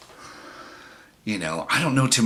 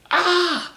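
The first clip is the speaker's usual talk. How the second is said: high imitated shriek of pain; sudden high yelp, mimicking her; mock scream; 3 dB louder than usual. Date screaming as she is bitten, mock scream. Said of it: "she screamed, and she's all".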